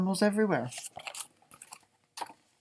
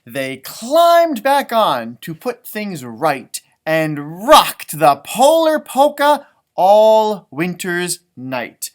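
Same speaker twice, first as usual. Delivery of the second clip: rhythmic read-aloud refrain, drawn out; sing-song story reading; 10 dB louder, much slower than usual. A narrator's reading. Parent reading the refrain to children, sing-song. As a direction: read it like a picture book chant.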